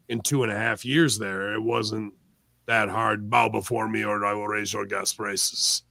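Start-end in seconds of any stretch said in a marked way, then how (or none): 3.3-5.8 dark lord. Retold or imitated. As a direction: threaten like a movie villain. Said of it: "in my voice".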